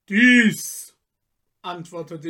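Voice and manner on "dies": louder, mocking forest spirit; scornful tempter. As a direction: lecture him with contempt. Michel shows his stone heart.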